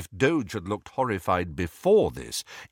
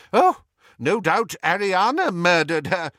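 loud, forced sarcasm; attempt at scorn; 9 semitones higher, 6 dB louder than usual. A narrator's reading. Old friend forcing scorn at Muriel, loud sarcasm. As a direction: try to sound scornful and half fail. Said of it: "with a brave attempt at scorn".

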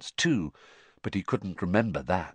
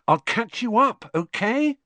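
put-on much higher girl's voice, rising; bright, higher-pitched schoolgirl echo; Hermione's voice; 12 semitones higher, 4 dB louder than usual. A narrator's reading. Hermione echoes Harry's line.

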